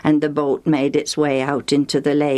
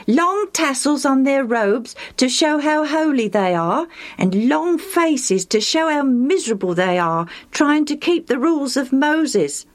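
higher, mocking old man voice; a high-pitched scornful storyteller; lightly voiced Lucius; 11 semitones higher than usual. A narrator's reading. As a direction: sneer at the Pharisees' show.

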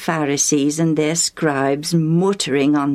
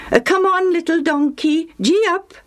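gee him up, calling out; calling to donkey; 12 semitones higher than usual. Acted. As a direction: rider urging his little donkey, called out high. Urging his donkey on.

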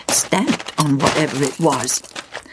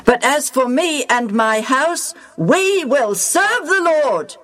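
raised, measured voice of a leader addressing the people; Joshua's solemn speech; 6 semitones higher than usual. Acted. Joshua to the Israelites, solemn proclaiming leader. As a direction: proclaim it to the whole nation.

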